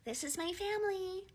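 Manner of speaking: high, soft gushing; a hint of a cheesy friend; mock proud group.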